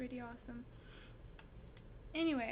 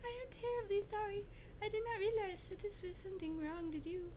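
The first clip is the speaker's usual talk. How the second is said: higher-pitched storybook apology; put-on higher girlish apology; apologetic little girl; 6 semitones higher, faster than usual. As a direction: play a girl saying sorry, pitched up. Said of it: "She said".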